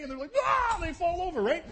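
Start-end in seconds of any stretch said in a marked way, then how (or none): 0.4-0.8 loud, high yelp of fright; startled mock scream. Freaked-out disciples seeing Jesus appear, startled mock scream. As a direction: scream as if caught by surprise. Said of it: "they're just kinda sorta sitting there freaked out".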